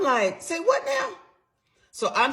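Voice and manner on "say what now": stunned disbelief. Retold or imitated. Shocked reaction to husband's confession.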